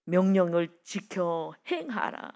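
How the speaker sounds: slow, measured scriptural command; weighty, drawn-out recital; solemn command. Acted. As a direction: spell out the command slowly and deliberately.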